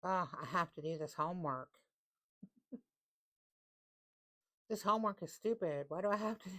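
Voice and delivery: lightly voiced sulky student; whiny student. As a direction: whine like a kid stuck with homework. Mock whining student about homework.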